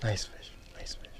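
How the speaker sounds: breathy whisper to the fish. Real, not acted. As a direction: coax the fish softly under your breath.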